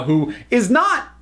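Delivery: ordinary talk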